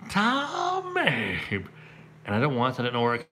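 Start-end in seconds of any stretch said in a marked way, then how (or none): 0.1-1.4 sudden high-pitched yell of his own name; screaming own name. Retold or imitated. Comedian reenacting his onstage tic outburst, high yell. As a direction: shriek "Tommy!" like an involuntary tic. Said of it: "I start screaming my name".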